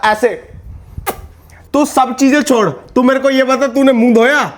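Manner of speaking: normal talking voice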